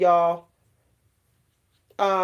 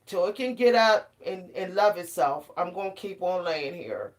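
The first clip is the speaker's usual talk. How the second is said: drowsy reply; 3 semitones lower, 6 dB quieter than usual. Retold or imitated. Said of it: "I'll be like".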